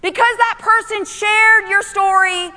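urgent, high-pitched sermon delivery; full-force preaching. Acted. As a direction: drive each phrase home to the congregation.

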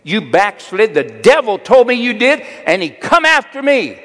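imitated wife's high, loud accusing yell; Phyllis. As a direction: yell it at full pitch, building in outrage. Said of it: "Phyllis yells in a loving voice".

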